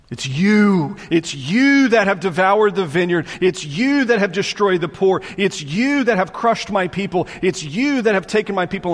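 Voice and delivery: stern accusing preacher's voice; preached accusation. Acted. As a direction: jab each "you" at the leaders. God's accusation preached with force.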